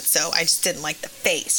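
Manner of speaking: usual speaking voice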